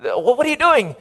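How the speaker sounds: acted-out exasperation with a parent; embarrassed son. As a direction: scold dad in halting, embarrassed starts. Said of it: "that embarrassed the son".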